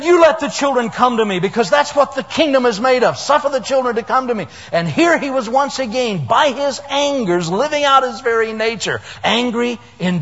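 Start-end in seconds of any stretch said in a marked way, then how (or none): 0.0-4.5 put-on raised, firm call to let the children come; commanding Jesus. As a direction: speak it out like a stern shepherd. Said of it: "And he said".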